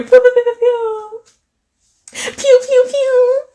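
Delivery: loud, very high laser sounds; playful pew-pew noises. Pretend. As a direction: shoot out squeaky pews in welcome. Imitated noises greeting a viewer.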